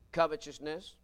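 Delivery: ordinary talk, nothing marked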